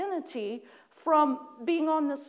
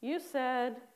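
slow, sulky mock recital; grudging attitude; 2 semitones lower, 5 dB quieter, slower than usual. Worshipper answering God about enemies, slow and grudging. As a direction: drag it out like a reluctant pupil, fading. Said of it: "the kind of attitude I answered with".

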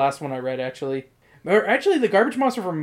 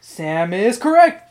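game show host; 4 semitones higher, 6 dB louder, slower than usual. Pretend. Quiz host announcing.

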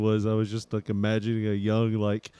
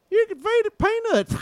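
squeaky voice, much higher; 3 dB louder than usual. Pretend. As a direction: squeak it in a cartoon pitch. High mock voice clip.

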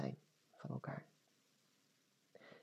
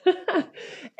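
a high burst of laughter; short laugh. Real, not acted. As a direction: laugh at yourself. Laughs at her past self.

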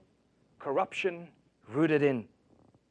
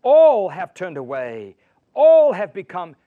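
much louder, booming declaration; thundering scripture; 13 dB louder than usual. Acted. Preacher reading Romans to his flock, booming. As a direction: boom it out over the church.

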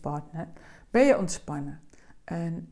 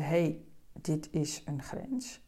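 slow, soft inner noticing, half the usual pace; 3 semitones lower, 7 dB quieter, much slower than usual. Shown as a demonstration. Coach voicing a slow inner check for listeners, slowed and soft. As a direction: ease off and feel each word as you find the limit. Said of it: "En je hoort het al aan mijn stem, daar moet je voor vertragen".

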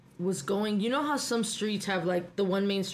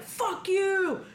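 angry retort; 10 semitones higher than usual. Retold or imitated. Yelling back at the driver who almost hit her, angry shout. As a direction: spit it at the driver. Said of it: "It's like".

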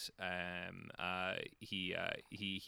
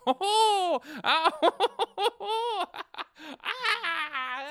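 shrill, very high and loud giggling hoots; goblin giggle; 7 dB louder, slower than usual. Acted. Goblin running off into the woods giggling, very high and loud. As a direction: cackle like a cheeky goblin fleeing. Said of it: "his little bum as he runs away going".

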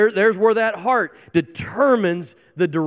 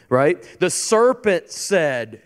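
ordinary talk

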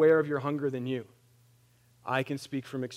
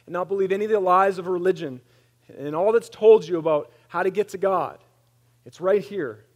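louder, quicker pleading; fervent preaching appeal; 4 semitones higher, 8 dB louder than usual. Acted. Pastor urging the congregation, loud. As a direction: press the call home.

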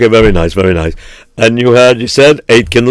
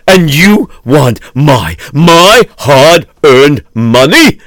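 rising furious outburst; shaking with anger; 3 semitones higher, slower than usual. Acted. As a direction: build from indignant to shouting him out. Host turns on beggar.

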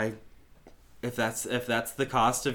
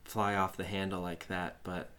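apologetic; 5 semitones lower, 9 dB quieter than usual. Acted. Player apologizes to guard.

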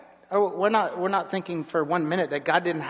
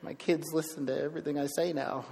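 softer, retold sheepish admission; 7 dB quieter than usual. Dad realizing his kids copy him, sheepish. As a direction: say it small, like a dad caught out. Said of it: "I was just sheepishly going".

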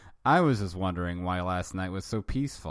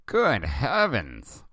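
slow, loud "Good heavens"; alarmed exclamation; 6 semitones higher, 7 dB louder, much slower than usual. Acted. Willie realizing he's late, alarmed and loud. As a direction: jump up in alarm.

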